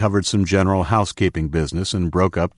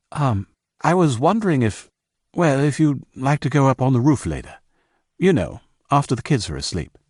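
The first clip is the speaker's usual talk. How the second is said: higher, hesitant asking; nervous invitation; 7 semitones higher than usual. Acted. Ben invites Diana to the roof, hesitant. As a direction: feel your way into asking them out. Shy boy asks girl out.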